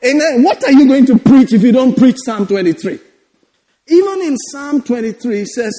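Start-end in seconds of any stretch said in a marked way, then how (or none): none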